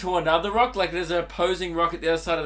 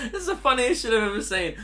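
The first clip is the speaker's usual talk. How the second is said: giggly; 7 semitones higher, faster than usual. Real, not acted. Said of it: "I just couldn't stop laughing".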